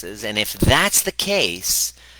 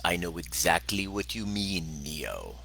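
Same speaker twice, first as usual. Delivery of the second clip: Morpheus voice; 4 semitones lower, 9 dB quieter than usual. Retold or imitated. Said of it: "And Morpheus says".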